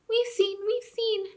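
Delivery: very high squeaky voice; squealing mice. A narrator's reading. Mice answering the king, high squeal. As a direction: squeak it out eagerly. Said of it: "squealed the mice".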